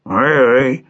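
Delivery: grumbling king, mouth half closed; grommellement. A narrator's reading. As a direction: grumble a doubtful assent as the king. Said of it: "grommela le roi". (grommeler comme un roi bougon)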